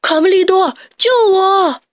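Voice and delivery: panicked shout; shout for help. Acted. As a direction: yell desperately up from the tree. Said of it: "大喊".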